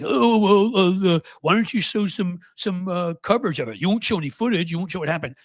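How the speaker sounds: high, loud mock whining; hammed-up high whimper of a politician; whiny crybaby. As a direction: whine like a caught-out kid. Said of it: "cried and whined and was like".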